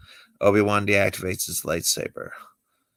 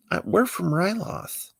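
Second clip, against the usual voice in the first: put-on high girlish voice, a bit softer; Twi'lek woman; 10 semitones higher, 3 dB quieter than usual. Twi'lek telling Kenobi where she's from, high girlish voice. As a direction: answer up high and a little shy.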